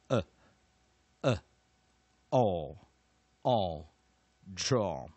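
slow, firm teacher's drill chant; loud, deliberate modelling for learners to repeat; pronunciation drill recitation. Shown as a demonstration. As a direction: recite each rule in spaced, emphatic chunks. Leads a final pronunciation drill.

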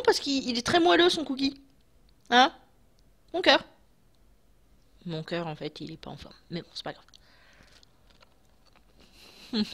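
chewing while talking; taking bites between words; mouth full of cookie. Real, not acted. Seen 1: Holds cookie throughout, bites at 2.0–3.1s, mouth visibly working.

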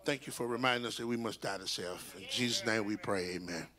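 low, steady prayer voice. Real, not acted. Pastor leading congregation in closing prayer, calm. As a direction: pray it calmly to close.

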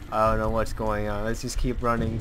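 ordinary talk, nothing marked

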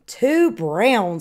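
ordinary delivery, nothing marked

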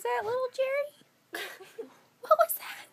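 high, cooing little voice. Real, not acted. Talking to little Jerry, high baby talk. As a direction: coo it up high to a little one.

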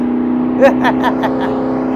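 villain cackle. Acted. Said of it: "도둑 해피".

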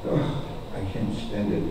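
low, soft acted despair; exasperated aside. Teacher fed up with baby talk, quiet exasperated aside. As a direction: drop to a weary low grumble.

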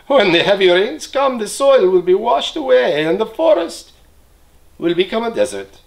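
a troop of monkeys pleading, high and loud; chattering monkey. Acted. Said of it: "They chattered to the sleeping man".

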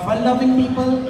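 normal talking voice

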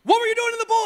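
high, loud scolding question; exasperated yelling. Retold or imitated. Father questions daughter who was in the pool, exasperated yelling. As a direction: yell it in parental disbelief. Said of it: "I'm sorry for yelling".

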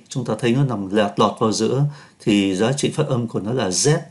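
normal talking voice

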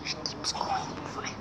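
slow, soft unvoiced whisper. Real, not acted. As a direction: whisper it while you're hiding. Whispering while hiding in the game.

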